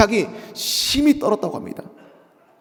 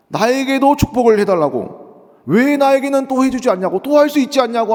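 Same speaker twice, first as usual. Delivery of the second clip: higher and louder, pleading cry; 8 semitones higher, 4 dB louder than usual. Retold or imitated. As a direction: plead loudly like the cheated son. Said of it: "이렇게 소리를 칩니다".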